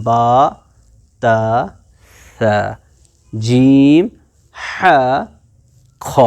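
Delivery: با تا ثا said the Arabic way, one by one; teacher says the alphabet in Arabic; Arabic letter names. Shown as a demonstration. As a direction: recite the letters in Arabic, clearly spaced. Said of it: "Arabic میں بول رہا ہوں".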